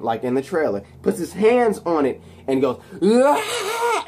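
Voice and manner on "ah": high strained yell; mock scream of surging electricity; imitated power scream.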